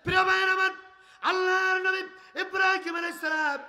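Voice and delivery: high, loud preacher's call; সুর তুলে ওয়াজের ডাক; preaching at full force. Acted. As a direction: rouse the crowd. Preacher calling out to crowd.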